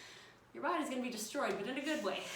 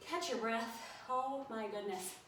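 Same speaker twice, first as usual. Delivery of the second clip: ordinary talk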